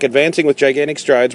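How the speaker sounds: ordinary delivery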